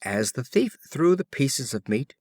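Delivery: plain reading voice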